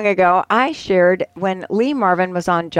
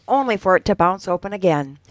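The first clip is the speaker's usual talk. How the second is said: usual speaking voice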